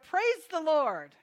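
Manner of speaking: loud, high, stretched-out praise; drawn-out proclamation; joyful shout. Retold or imitated. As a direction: call it out slowly from the porch. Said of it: "stand on her porch and shout".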